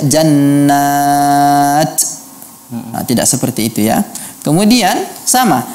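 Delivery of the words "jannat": jannat ending with a hiss like s; imitating the error, slow sibilant t at the end. Shown as a demonstration. Teacher imitates the hissing ta to avoid. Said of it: "bukan dibaca".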